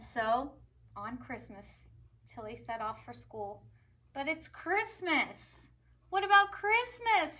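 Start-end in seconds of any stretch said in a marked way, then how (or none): 4.1-7.4 put-on high, loud kid's complaint; little brother protesting. A narrator's reading. Brother calls after Tilly, high and loud. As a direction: wail after his sister. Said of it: "her brother called".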